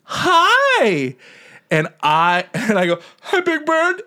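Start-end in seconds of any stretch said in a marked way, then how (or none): 0.0-1.1 very high, drawn-out puppet hello; Big Bird. Retold or imitated. Voices Big Bird greeting him back. 3.2-4.0 tiny high voice about to cry; mock tearful. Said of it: "And I immediately start crying".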